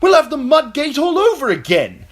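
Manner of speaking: high, loud furious shout; wounded knight shouting at his queen; angry shout. A narrator's reading. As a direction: yell it at the queen in fury. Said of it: "as he shouted".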